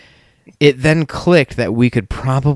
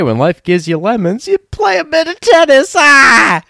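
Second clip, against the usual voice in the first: high, loud punchline building to a yell; hammed-up shout; 6 dB louder than usual. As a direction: build to a mock-triumphant scream.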